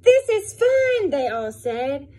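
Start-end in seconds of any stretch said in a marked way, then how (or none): 0.0-1.1 very high, loud and slow delighted cry; storybook crowd of animals, thrilled; joyful cheer. Acted.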